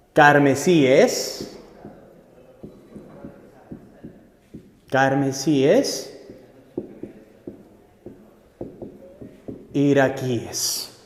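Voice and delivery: each plural drawn out very slowly with pauses between; slow dictation. Shown as a demonstration. Teacher dictating plural forms to students, very slow. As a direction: spell out every word at half speed. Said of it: "la única forma de plural que me va a aceptar es".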